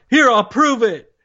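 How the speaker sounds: high-pitched mock outburst; mock angry yell. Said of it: "yelling at each other".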